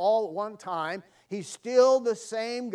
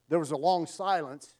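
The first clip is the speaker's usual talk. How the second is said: normal talking voice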